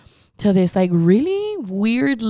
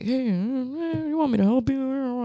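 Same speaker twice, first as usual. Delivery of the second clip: put-on creepy old man; 7 semitones higher, 5 dB quieter than usual. Creepy old guard offering help.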